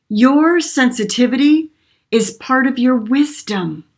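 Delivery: channeled message. Acted.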